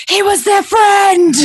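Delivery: high, loud hammed-up yell; random mock shout. Friends yelling the line at lunch, mock shout. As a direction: blurt it out of nowhere. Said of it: "That was a line that my friends".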